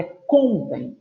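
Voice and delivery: "contem" slowly as CON-tem; stress on first syllable. Shown as a demonstration. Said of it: "é a penúltima".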